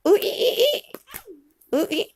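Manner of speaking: very high monkey squeal; monkey sounds. Pretend. Parent prompting child with monkey noise, high squeals.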